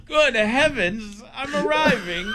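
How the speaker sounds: camp it up as a posh Brit, laughing through it; mock British accent. Reading a viewer's joke, mocking British men in a high British voice. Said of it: "British men say".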